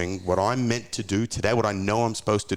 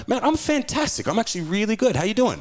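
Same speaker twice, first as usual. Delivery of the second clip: over-the-top cheerful; 13 semitones higher than usual. Retold or imitated. His upbeat reply to greetings.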